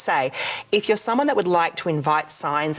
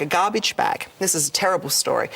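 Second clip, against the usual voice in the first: ordinary delivery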